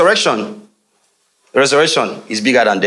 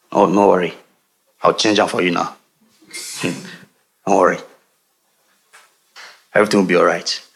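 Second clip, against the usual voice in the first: gentle comfort; 7 semitones lower than usual. Acted. Jesus comforting the grieving widow, low and gentle. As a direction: soothe her gently.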